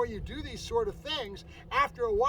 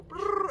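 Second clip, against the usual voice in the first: high, sharp brrr sound; a quick rolling brrr to nip the dog down; correction growl; 11 semitones higher than usual. Shown as a demonstration. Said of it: "right away you go".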